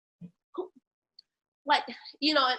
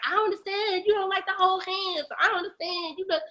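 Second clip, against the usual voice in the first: put-on higher, whiny boyfriend whine; mock confused boyfriend; 8 semitones higher than usual. Boyfriend questioning her, mocked in higher whine. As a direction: mimic a baffled boyfriend. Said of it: "I've had boyfriends be like".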